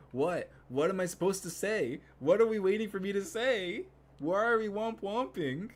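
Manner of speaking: high, loud, baffled outburst; playfully indignant yelling; exasperated protest. Pretend. Playful protest at chat's womp womping.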